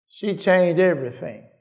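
ordinary talk, nothing marked

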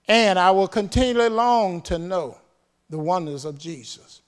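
loud, high proclamation of scripture; forceful preaching. Acted. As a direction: declare the verse with full force. Preacher proclaims a paraphrased verse.

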